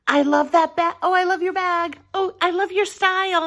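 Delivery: high-pitched, admiring gush; gushing compliment. Retold or imitated. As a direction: gush as if complimenting someone's outfit. Acting out giving compliments.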